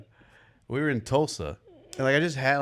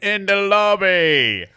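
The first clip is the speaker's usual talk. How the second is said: high, loud, slow British voice; mock British accent; 10 semitones higher, 9 dB louder, slower than usual. Repeating it while she is laughing, mock British accent. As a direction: belt it out stretched in a hammed-up British accent. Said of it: "doing it in a British accent".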